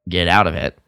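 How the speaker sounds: ordinary talk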